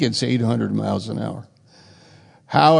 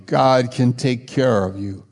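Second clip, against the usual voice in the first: ordinary talk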